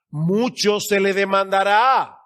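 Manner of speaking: slow, loud scripture proclamation; forceful preaching. Real, not acted. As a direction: proclaim the verse slowly, drawing out the endings.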